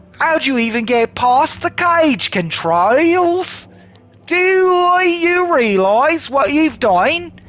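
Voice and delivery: hammed-up Australian outrage; Australian accent. El Hinto scolds the releaser, loud Australian accent. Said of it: "I said I could do Aussie".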